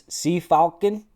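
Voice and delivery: attempted German pronunciation. Shown as a demonstration. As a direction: sound out Seefalken in mock German. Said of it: "with how I pronounce this".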